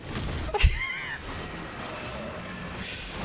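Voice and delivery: high breathless squeal of laughter; shrieking laugh. Real, not acted. Laughing at mom's story.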